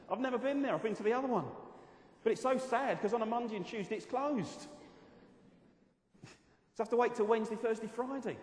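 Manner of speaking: loud, high, fast-building sermon delivery; preaching at full force. Acted. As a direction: preach it out to the congregation.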